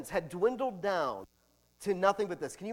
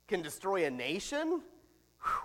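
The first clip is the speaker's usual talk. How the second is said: ordinary talk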